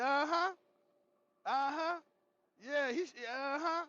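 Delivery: high, slow nervous uh-huhs; nervous agreeing. Retold or imitated. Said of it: "I said".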